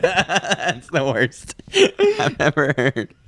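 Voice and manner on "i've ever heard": ordinary talk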